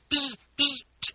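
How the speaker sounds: imitated high buzzing beeps; short, loud, high buzzes like Morse code; Morse code whistle. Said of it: "do this Morse code whistle".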